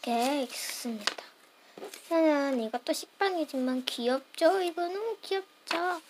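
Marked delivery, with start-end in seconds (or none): none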